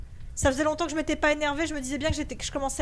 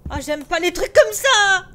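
raised scared voice; loud scared complaint; 4 semitones higher, 10 dB louder than usual. Real, not acted. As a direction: blurt it out loudly, frightened. Scared, reacting in horror game.

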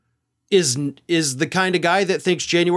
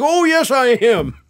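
higher, louder proud mock confirmation; hammed-up eager Mike Lindell; Mike Lindell impression; 7 semitones higher, 4 dB louder than usual. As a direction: answer like you're thrilled to sue. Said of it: "My Mike Lindell impression is actually getting worse".

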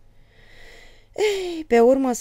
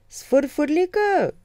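high drawn-out call to the little one; calling the child; 11 semitones higher, 8 dB louder, much slower than usual. Retold or imitated. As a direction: sing it out slowly to the child, high. (strigare lungă, înaltă, către copil)

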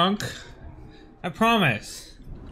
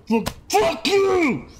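high, drawn-out exasperated yell; sudden angry outburst; 12 semitones higher, slower than usual. Real, not acted. Player attacked mid-story, sudden angry outburst. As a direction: burst out high and long at the enemy.

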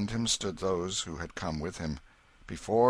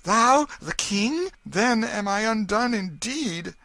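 put-on high, slow, horrified pauper boy; boy realizing he faces the king; frightened exclamation; 14 semitones higher, 9 dB louder, slower than usual. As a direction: gasp it out in dread. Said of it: "he exclaimed".

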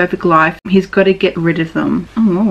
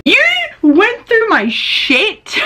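outraged disbelief; 13 semitones higher, much slower than usual. Acted. Angry quarrel over stolen journal.

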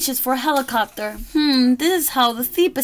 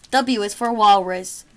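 plain reading voice

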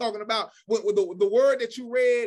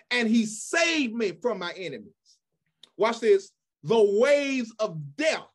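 slow, emphatic pulpit reading; scripture declamation; 3 dB louder than usual. Acted. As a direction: declaim each phrase, stretching the key words.

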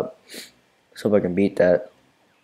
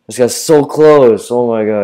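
panicked shout; 5 semitones higher, 12 dB louder, slower than usual. Real, not acted. Enemy getting close.

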